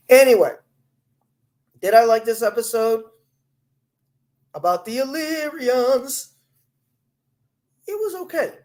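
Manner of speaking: wired and jumpy, pitch leaping high; buzzing on four cups of coffee; too much caffeine. Real, not acted. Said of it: "If I talk like I'm going nuts".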